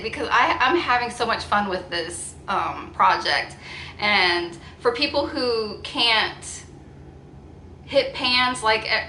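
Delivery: ordinary talk, nothing marked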